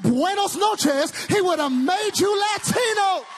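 loud, high, sung-out preacher cadence; full-force preaching. Acted.

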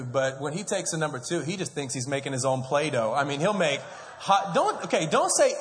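usual speaking voice

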